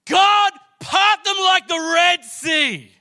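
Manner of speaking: slow, full-force high shout; shouted, drawn-out plea to God; declaring prayer. Retold or imitated. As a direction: cry it out as a booming declaration to heaven. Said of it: "started declaring".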